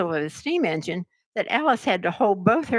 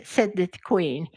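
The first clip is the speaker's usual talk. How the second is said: usual speaking voice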